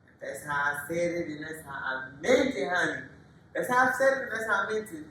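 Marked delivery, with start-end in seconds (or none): 3.5-5.0 louder and higher, owning what he said; sassy defiant declaration; defiant loud boast. Real, not acted. Defiant about the insult, loud and high.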